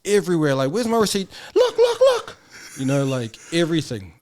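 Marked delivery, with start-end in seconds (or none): 0.7-2.4 pushy mom. Retold or imitated. Mom demanding the receipt from staff, pushy. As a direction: mimic mom jabbing at the receipt.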